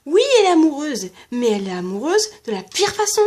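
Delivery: loud exasperated outburst; angry rant. Real, not acted. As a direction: vent the frustration. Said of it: "Bon, allez, je me calme".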